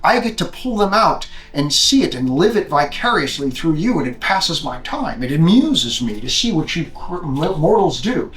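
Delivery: put-on grand Scottish-accented god of the dead, monologuing; rolling Scottish burr, lordly and deliberate; Sean Connery. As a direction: lecture the mortals with theatrical, swinging emphasis. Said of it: "Hades talks like Sean Connery, a Scot".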